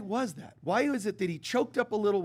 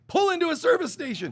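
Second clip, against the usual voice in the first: raised, louder voice of God nudging; God's voice; 10 semitones higher, 6 dB louder, faster than usual. Retold or imitated. God urging the driver to stop, raised and loud. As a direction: call it out high like God's urging. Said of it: "And God's going".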